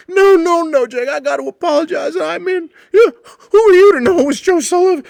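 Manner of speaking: put-on stumbling drunk pleading sorry; drunk apology. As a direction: drunk regular begging forgiveness, high and loud. Drunk Butch keeps apologizing.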